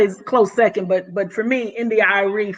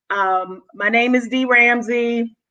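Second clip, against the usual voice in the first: normal talking voice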